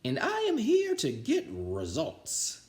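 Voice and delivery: very slow, high put-on witchy teacher voice; menacing substitute. A narrator's reading. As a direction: drag out each word to intimidate. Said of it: "said the lady".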